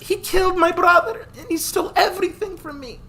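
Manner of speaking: put-on high young voice, bitter and tearful; a young woman demanding revenge for her brother; grieving girl. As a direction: spit out the hatred through grief. Lisha's voice in the story.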